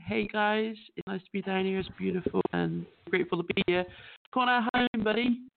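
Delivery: normal talking voice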